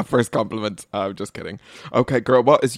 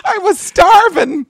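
slow, high-pitched shout; high comic wail; 10 dB louder than usual. Real, not acted. Woman protesting to her friend about losing weight, high comic wail. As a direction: wail it loud and drawn out.

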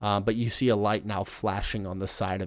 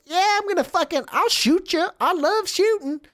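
very high, loud, gleeful gunman; high loud goon bragging; trigger-happy guard; 5 dB louder than usual. Acted. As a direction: crow about loving to shoot. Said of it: "the other one goes".

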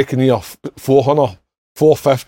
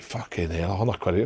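much softer, dropped right down, stunned; a teenager hearing a low wage offer, softly stunned; stunned aside; 7 semitones lower, 13 dB quieter than usual. Retold or imitated. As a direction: mutter the disbelief under your breath. Shocked reaction to offer.

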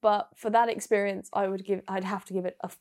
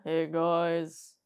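mock low man's voice; George; 6 semitones lower, 3 dB quieter than usual. Cohost poking fun at the hurdler, mock low voice. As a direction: drop low to imitate a deep-voiced man. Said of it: "He has an incredibly low voice".

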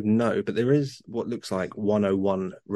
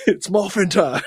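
hammed-up battle cry; 8 semitones higher, 5 dB louder, slower than usual. Quoting Power Rangers shout.

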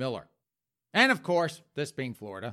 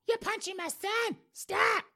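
put-on very high shrill old mother; high falsetto mama pleading; slower than usual. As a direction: squeal like a panicked mom. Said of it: "Mama's there in the hallway going".

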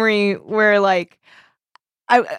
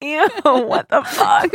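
laughing disgust; 6 semitones higher, much slower than usual. Real, not acted. Reacts to baby talk.

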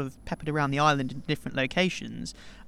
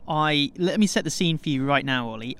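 ordinary delivery

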